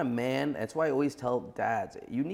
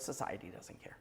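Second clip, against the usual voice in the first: hushed bitter whisper; 4 semitones lower, 13 dB quieter than usual. Real, not acted. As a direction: drop to a sudden whisper. Bitter aside in heated talk.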